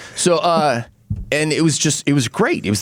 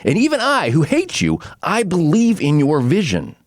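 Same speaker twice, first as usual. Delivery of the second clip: higher, earnest half-shouted plea; Will Scarlet revealing he is the stepbrother, impassioned; impassioned confession; 8 semitones higher than usual. Retold or imitated. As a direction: pour out the resentment then rally him. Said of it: "And he's like".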